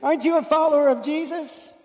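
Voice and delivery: put-on high, loud, nosy little girl voice. Little girl pesters Peter at the fire, high and nosy. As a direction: pester him like a curious child. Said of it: "And she's like".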